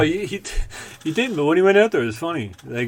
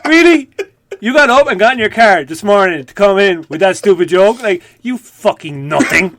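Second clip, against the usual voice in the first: much higher, louder scornful tirade; contemptuous Patrice rant; 7 semitones higher, 9 dB louder than usual. Retold or imitated. Patrice belittles Voss over a stupid joke, scornful tirade. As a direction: tear into a bad joke with disgust. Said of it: "He's like".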